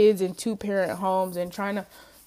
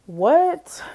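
high, loud, rising what; amazed; 8 semitones higher, 7 dB louder than usual. Real, not acted. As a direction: exclaim it in amazement.

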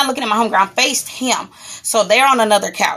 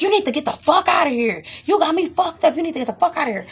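high-pitched indignant eviction, re-enacted; retold fury, pitched up without shouting; angry outburst; 8 semitones higher, faster than usual. Woman ordering out the man who choked her, angrily. Jumps up, throws him out.

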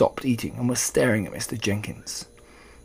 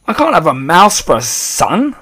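loud, higher protest; mild mock shriek; 5 semitones higher, 9 dB louder than usual. A narrator's reading. Father rejecting a mouse son, half shrieking. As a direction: cry it in dismay, short of a real shriek. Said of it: "shrieked Mister Jenkins".

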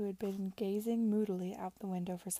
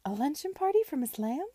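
higher, louder astonished question; surprised; 7 semitones higher, 9 dB louder than usual. A narrator's reading. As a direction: exclaim it as a rising question. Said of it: "Clara was surprised again".

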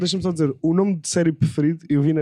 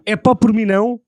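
said out louder and higher; loud line delivery; 4 semitones higher, 5 dB louder than usual. Shown as a demonstration. Friend asks him to say it again, delivered loud. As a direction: belt it out like a performer. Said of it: "Agora diz".

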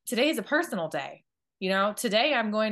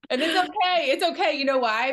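high, loud and encouraging; emphatic reassurance; 6 semitones higher, 5 dB louder than usual. Real, not acted. Co-host reassuring friend who fell down the hill, emphatic. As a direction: cut in with insistent encouragement.

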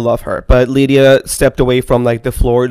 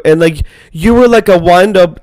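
normal talking voice

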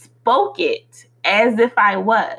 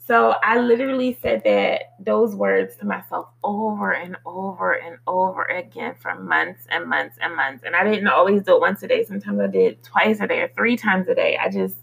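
ordinary talk, nothing marked

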